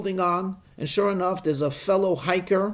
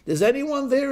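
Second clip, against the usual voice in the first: echoed shout; 8 semitones higher than usual. Acted. Echo of the hiker calling back, shouted question. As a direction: call out like a cliff echo. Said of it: "he hears himself shouting back".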